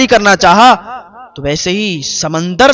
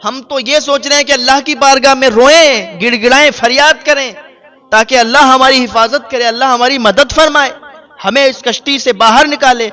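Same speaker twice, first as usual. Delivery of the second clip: higher, louder, urgent reproach; indignant pleading; 6 semitones higher, 3 dB louder than usual. Acted. Stranded woman reproaching the man, loud and urgent. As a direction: plead and scold in one breath.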